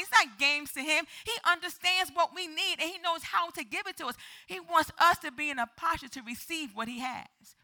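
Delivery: high, emphatic sermon delivery; forceful preaching. Real, not acted. Preacher insisting God is not a jokester, forceful. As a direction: argue it hard to the congregation.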